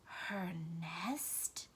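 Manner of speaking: whispered surprise; a hushed, startled question; taken aback. A narrator's reading. Ragweed surprised by Blinker, whispered. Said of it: "Ragweed was taken aback".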